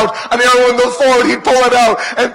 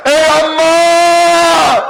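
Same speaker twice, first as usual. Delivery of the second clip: wailing cry to mother; 5 semitones higher than usual. Acted. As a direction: wail it out to Amma as he falls. Hussein calls out to his mother.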